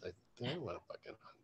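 refusing to go hunting, fast whisper; whispered refusal. Retold or imitated. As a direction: rush it out under your breath.

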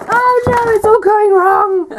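high, loud mock-panicked wail; hammed-up dismay. Real, not acted. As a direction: squeal it like everything is collapsing. Book slipping, mock panic.

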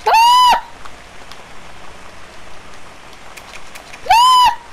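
shrill, very high, loud shriek of fright; terrified scream. Real, not acted. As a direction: scream at the jump scare. Jump scare in horror game.

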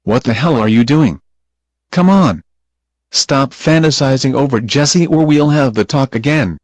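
very loud, exasperated scolding; angry shout. Acted. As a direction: yell at Doris to snap out of it. Angry scolding of daydreaming Doris.

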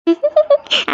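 high, shy little laugh; bashful giggle. Acted. Bride teased about her shyness, bashful giggle. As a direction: giggle as if caught blushing. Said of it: "ஜனனிக்கு வெக்கத்தை பாரேன்".

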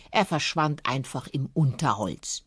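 usual speaking voice

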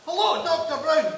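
calling out. Acted. Calling up to the doctor.

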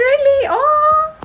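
very high-pitched surprise; high, gushing "oh"; surprised squeal. Real, not acted. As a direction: squeal it in amazement. Reacts to a viewer's comment.

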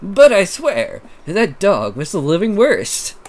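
hammed-up New York accent. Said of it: "my New York".

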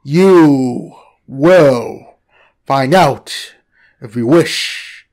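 low, very slow serpent voice; mystic snake. Acted. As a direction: speak like an ancient oracle. Snake speaks.

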